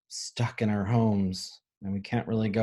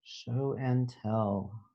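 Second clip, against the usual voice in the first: ordinary delivery, nothing marked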